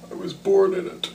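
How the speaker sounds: lightly done high muffled villain voice; a hint of a masked brute; Bane impression. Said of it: "the darkness".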